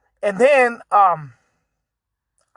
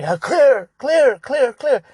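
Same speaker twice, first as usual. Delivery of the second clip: imitating officers calling "clear", higher than usual; mock calling out; 6 semitones higher, 3 dB louder, slower than usual. Retelling police searching his house, mock calling out. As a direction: call it out like a room-by-room sweep.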